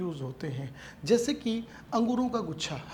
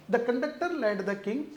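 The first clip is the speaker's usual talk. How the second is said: very slow, word by word, rising on conductor; slow re-reading; 3 semitones higher, much slower than usual. Shown as a demonstration. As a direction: read the phrase back slowly to the class. Re-reads part of the sentence. (धीरे-धीरे दोबारा पढ़ना)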